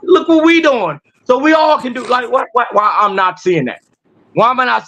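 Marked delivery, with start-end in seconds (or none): 0.0-1.0 higher-pitched, mildly hyped-up announcement; proud announcing. Acted. People building on land telling others, proudly announcing. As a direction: voice people showing off their progress, pitched up. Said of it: "y'all telling people".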